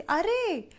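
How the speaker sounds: high-pitched exclamation of recognition; surprised recognition. Retold or imitated. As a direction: exclaim as you spot someone famous. Lady suddenly recognizes her, retold.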